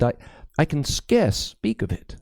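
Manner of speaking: put-on nervous young priest apologizing to Crispin; high, timid priest, anxious and halting; fearful young priest. A narrator's reading.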